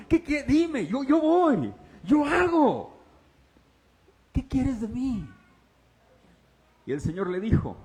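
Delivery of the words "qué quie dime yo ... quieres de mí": high loud hurried outburst; almost shouting, eager to act; choleric eager. Acted. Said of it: "Como colérico". (Saulo colérico, casi gritando)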